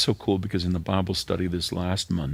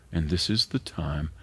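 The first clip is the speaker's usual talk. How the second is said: ordinary talk, nothing marked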